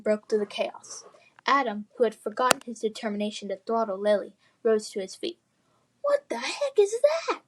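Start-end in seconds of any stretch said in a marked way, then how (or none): 6.0-7.4 high, startled exclamation; startled cry. Acted. Adam shocked by the knight, high startled cry. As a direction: blurt it out in shock, pitched high. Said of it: "he cried".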